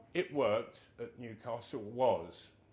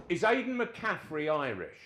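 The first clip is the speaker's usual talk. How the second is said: higher, louder, blunt demanding query; loud, pitched-up question to the squad; manager's loud question; 7 semitones higher, 7 dB louder than usual. Retold or imitated. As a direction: call it across the dressing room. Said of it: "he said".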